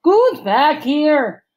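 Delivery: loud, slow, high angry call; demanding. Acted. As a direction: bark out the demand. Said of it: "Magnus demanded".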